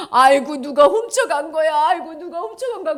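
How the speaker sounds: high wail, loud then easing; mock weeping. Mary at the empty tomb, mock tearful wail. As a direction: bawl it in hammed-up grief. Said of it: "질질질질 울고 있는데".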